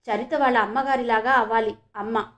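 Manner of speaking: usual speaking voice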